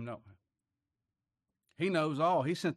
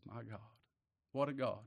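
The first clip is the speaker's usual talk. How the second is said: hushed, retold awe; tearful awe; 3 semitones lower, 8 dB quieter than usual. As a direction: softly imitate a man overcome by God. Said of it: "tears streaming down his face".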